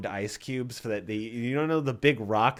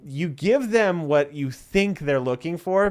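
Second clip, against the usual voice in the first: usual speaking voice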